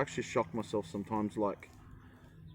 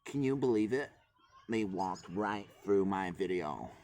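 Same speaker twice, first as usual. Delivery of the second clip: put-on American accent. Mocking influencers, a light American accent. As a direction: complain in a mild American accent. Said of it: "what do American accent?".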